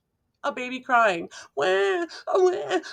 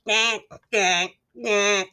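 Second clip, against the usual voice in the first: slower, fuller gank; big ducks quacking; much slower than usual. A narrator's reading. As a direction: quack like a big cautious duck. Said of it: "The big ducks say".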